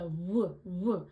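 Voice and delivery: "w" as an isolated "wuh"; W fricative. Shown as a demonstration. Singer showing the fricative, separated "w" sounds. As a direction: round into each "w" as its own sound. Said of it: "finding that fricative".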